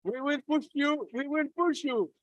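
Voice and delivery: high-pitched rallying call; excited calling out. Real, not acted. Organizer cheering on partners at the closing, excited calling out. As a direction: call it out high, twice over.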